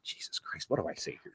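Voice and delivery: whispered inner panic, under the breath; panicked whisper. Retold or imitated. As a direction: hush it like a private moment of dread. Retold panicked inner thought.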